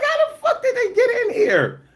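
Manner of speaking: high laughing shout of disbelief; incredulous yell. Retold or imitated. Retelling break-in, shouting incredulously.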